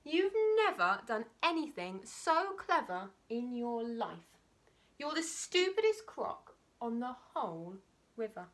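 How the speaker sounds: high, loud and scornful; small croc mocks the big one, high and scornful; scolding little crocodile. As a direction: tell him off like a cheeky little sibling.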